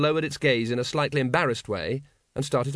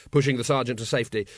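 ordinary delivery

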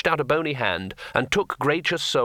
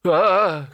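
loud, higher yelp of terror; cry of fright; 6 semitones higher, 6 dB louder than usual. Acted. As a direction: yelp at the sudden drop. Looks down in terror.

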